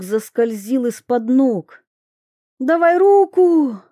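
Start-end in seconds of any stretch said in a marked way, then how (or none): 2.6-3.8 high, loud cry for help; urgent shout. A narrator's reading. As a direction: call out to grab her hand. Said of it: "крикнула она".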